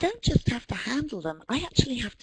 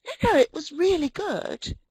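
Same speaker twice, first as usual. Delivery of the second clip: higher-pitched acted insistence; insistent reassurance; 7 semitones higher, 3 dB louder than usual. American reassuring a modest British speaker, acted insistent praise. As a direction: play someone lightly insisting on a compliment. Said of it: "they're going to have to come back and say".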